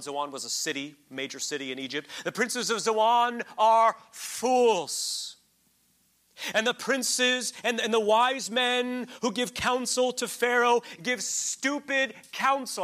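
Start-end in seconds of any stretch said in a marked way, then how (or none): 2.2-12.8 slow, loud, high proclamation of the verse; preaching scripture. Acted. Preacher reading Isaiah's rebuke to his congregation, slow and loud. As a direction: declaim each word like a prophet.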